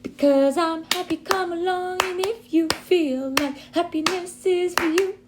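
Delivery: happy voice. Shown as a demonstration. Teacher showing the class a happy voice. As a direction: sound as happy as you can. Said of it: "someone who is happy".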